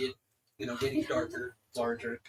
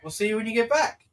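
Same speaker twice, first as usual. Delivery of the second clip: high, loud farewell call; calling out goodbye; 10 semitones higher, 11 dB louder than usual. Acted. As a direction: shout a goodbye after them. Character calls farewell after travellers.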